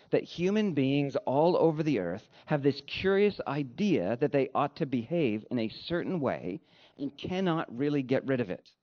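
ordinary delivery

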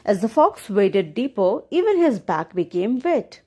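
plain reading voice

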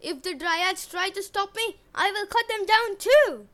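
building to a loud high threat; threatening brute. Acted. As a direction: menace her. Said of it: "Eric said".

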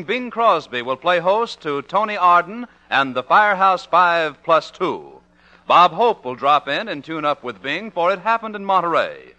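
ordinary talk, nothing marked

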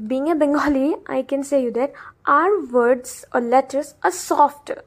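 ordinary talk

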